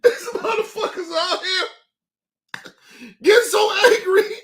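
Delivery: high, loud voice cracking up with laughter; helpless, squealing through real laughter; crying with laughter. Real, not acted. Reacting to angry people online, crying with laughter. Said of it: "Y'all done got me crying".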